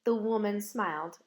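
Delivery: ordinary delivery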